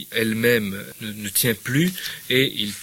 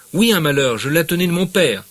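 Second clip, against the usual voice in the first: loud, higher wounded anger; aggrieved shout; 7 semitones higher, 9 dB louder than usual. Acted. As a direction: defend the heirloom with anger. Acted quarrel, Bouvard aggrieved.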